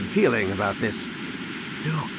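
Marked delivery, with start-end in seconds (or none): 1.8-2.1 faint, breathy whisper of a name; breathless whisper. A narrator's reading. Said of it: "Leia whispered breathlessly".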